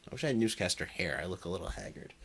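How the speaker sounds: normal talking voice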